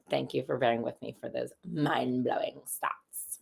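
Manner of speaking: softly whispered thanks; whispered aside. Real, not acted. Thanking viewers after the stats, in a whisper. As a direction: breathe it out as a sly aside. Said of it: "aside after stats".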